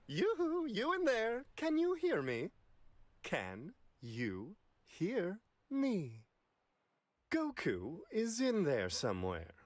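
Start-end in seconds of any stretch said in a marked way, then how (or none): 0.1-2.5 high, bright-toned yoo-hoo calling inward; much higher sing-song call to someone hidden inside. Acted. Whis calls into Goku's body. 3.2-6.2 slow repeated call. Acted. Whis repeats the call slowly.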